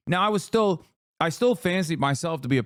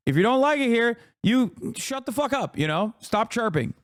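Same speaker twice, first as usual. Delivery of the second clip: higher-pitched, irritated telling-off; fed up, scolding in a raised pitch; real angry yelling; 6 semitones higher than usual. Real, not acted. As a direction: tell them off with your voice pitched up. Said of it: "Why do you yell so much".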